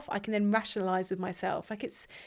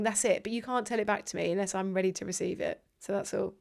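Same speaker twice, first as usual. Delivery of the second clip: normal talking voice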